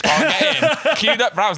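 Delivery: high, lively comic outrage; incredulous rant. Real, not acted. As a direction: rant it high through amusement. Incredulous rant about club door refusals.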